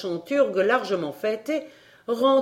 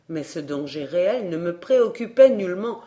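ordinary delivery

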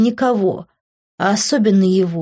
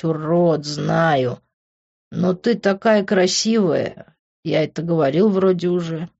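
put-on lower drunk tender confession; 4 semitones lower than usual. A narrator's reading. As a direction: murmur it close, drunk and tender.

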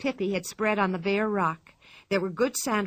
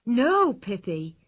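crying out; 2 semitones higher, 4 dB louder, slower than usual. A narrator's reading. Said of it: "cried both Tommy and Anika".

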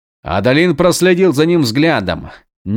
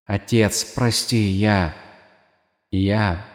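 hoarse breathing; 2 semitones lower, 5 dB quieter, slower than usual. A narrator's reading. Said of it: "хрипло дыша".

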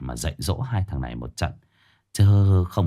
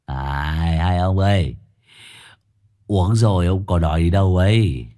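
put-on tipsy master slurring a warning to two drunk disciples; drunken slurred warning; 2 semitones higher than usual. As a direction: slur the warning like a tipsy old man. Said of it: "miệng lè nhè dặn".

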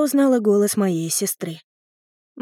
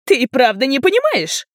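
higher-pitched incredulous question; amazed; 5 semitones higher, 4 dB louder than usual. A narrator's reading. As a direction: ask it in genuine disbelief. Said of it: "изумилась мама". (изумлённо, не веря)

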